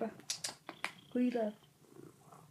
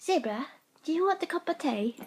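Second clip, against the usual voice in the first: put-on loud, high-pitched British accent; 6 semitones higher, 7 dB louder than usual. Offering Zebra the cat tea, British accent. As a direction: call it out in a hammed-up British accent. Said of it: "British accents I do very well".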